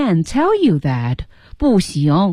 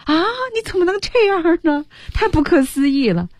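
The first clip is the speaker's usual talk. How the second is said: high, astonished cry of dismay; shocked disbelief; 11 semitones higher than usual. Acted. Friend hearing the secret, shocked and dismayed. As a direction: gasp it out as if betrayed.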